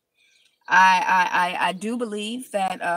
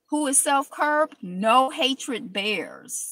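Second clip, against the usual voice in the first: drawn-out weighty reading; slow solemn reading; 4 semitones higher, 3 dB louder, slower than usual. Shown as a demonstration. Rereading the Buddha's saying, very slow and solemn. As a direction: savor each word slowly.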